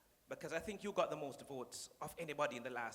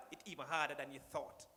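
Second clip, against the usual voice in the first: ordinary talk, nothing marked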